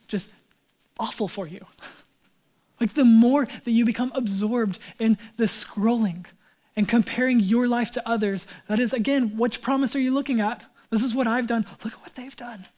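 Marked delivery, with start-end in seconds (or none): 2.8-11.7 louder preacher's warning; impassioned preaching. Real, not acted. Preaches with rising force. 11.8-12.7 mock-envious whisper; hushed envy. Envious comparison, acted after boast.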